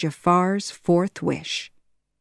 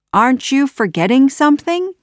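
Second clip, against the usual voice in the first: sly taunt; 8 semitones higher, 5 dB louder, faster than usual. Acted. Aladdin outwits Jafar.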